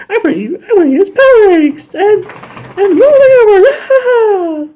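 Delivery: very high, loud witchy villain. Retold or imitated. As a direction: cackle through it like a cartoon witch. Voicing Rita's evil plan.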